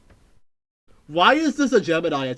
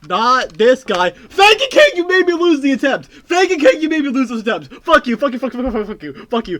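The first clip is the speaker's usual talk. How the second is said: loud, high mock-furious yelling; mock rage; 7 semitones higher, 9 dB louder than usual. Real, not acted. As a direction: blast it at the chatter in playful fury. Raging at chatter.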